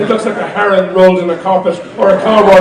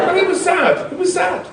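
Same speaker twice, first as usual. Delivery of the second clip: put-on child voice; 10 semitones higher, 5 dB quieter than usual. Daughter voice continues.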